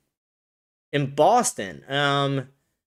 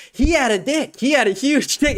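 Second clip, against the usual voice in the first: retold screaming; 7 semitones higher than usual. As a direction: imitate a wife goading her husband. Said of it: "she's screaming at him".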